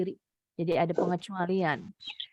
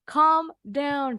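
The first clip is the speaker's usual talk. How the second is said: usual speaking voice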